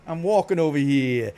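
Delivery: South Philly Italian accent, lightly put on. Streamer quoting a line about walking, South Philly Italian accent. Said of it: "they talk like that".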